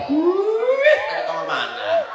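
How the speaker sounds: put-on high squeaky kid voice; squeaky child voice. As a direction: play a little child asking. Mocking a child's voice.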